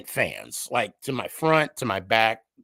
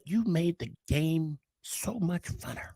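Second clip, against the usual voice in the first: lightly voiced old lady; 7 semitones higher, 8 dB quieter, slower than usual. Quoting sweet senior lady.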